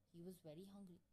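usual speaking voice

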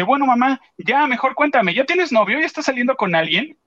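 high, lightly voiced daughter teasing mom; mock playful daughter.

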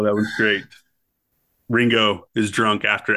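usual speaking voice

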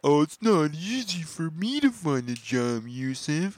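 sarcastic imitation of an excuse-maker; mock whiny excuse. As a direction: mimic a complaining worker. Mocking a lazy worker's excuse.

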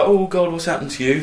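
lightly voiced panicked mother; fretting mum. Mum seeing her beaten son home, mild mock worry. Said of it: "his mum's all like".

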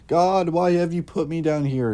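high, loud, pleading wail from the damned; a soul in hell crying upward; anguished cry. Retold or imitated. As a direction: cry out to God in despair. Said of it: "people screaming and crying".

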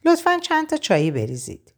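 a lady ordering tea haughtily; haughty. A narrator's reading. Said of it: "با فیس و افاده".

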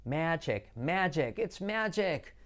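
"magic" as MEH-jek, repeated; hard j and short e in "magic"; pronunciación correcta. Shown as a demonstration. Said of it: "la G J". ("magic" repetido como «médchek»)

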